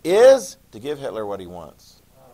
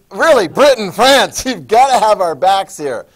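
higher, louder mock plea with a laugh; pleading Poland; 5 semitones higher, 10 dB louder than usual. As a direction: beg the allies for backup.

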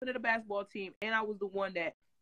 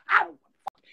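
angry retort; 7 semitones higher, 7 dB louder than usual. Retold or imitated. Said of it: "I'm like".

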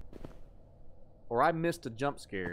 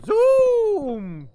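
very high, loud drawn-out zoom; shrieked zooming whoosh, held long; playful shout; 10 dB louder than usual. Pretend. Streamer playing with a viewer's thanks, playful high shout.

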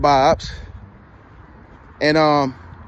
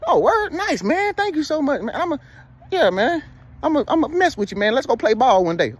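mock excited stranger, much higher; 7 semitones higher than usual. Stranger gushing over the fake basketball coach, excited high voice. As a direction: gush like an impressed stranger. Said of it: "I be like".

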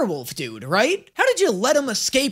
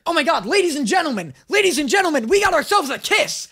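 excited; 7 semitones higher, faster than usual. Real, not acted. Gleeful reaction to a kiss.

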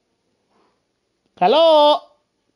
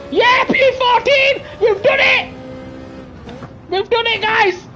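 excited shout; 10 semitones higher than usual. Real, not acted. Gamer celebrating his race result, shrieking with joy. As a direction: shout it out like you just won.

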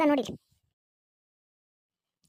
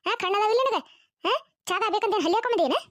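angry retort; 5 semitones higher than usual. Acted. Sister-in-law angrily denying she put a lizard in the tea. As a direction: bite back at the accusation.

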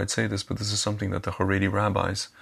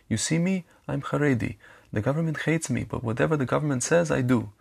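put-on kid's Haredi accent, pitched much higher; imitating Haredi accent; 6 semitones higher than usual. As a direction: mimic the sweet Haredi kid's accent, voice up high. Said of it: "He had a Hax accent".

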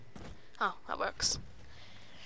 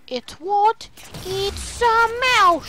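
exaggerated amazement; 6 semitones higher, slower than usual. Real, not acted. Gamer marvelling at a mouse, exaggerated amazement. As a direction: stretch it out in high, silly amazement.

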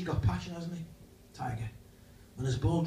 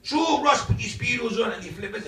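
ordinary talk

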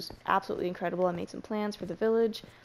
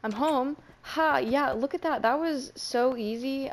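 cocky boast, a little louder and higher; mock triumphant; 4 semitones higher, 3 dB louder, slower than usual. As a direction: brag as if it was nothing. Arrives home, pretending it was easy.

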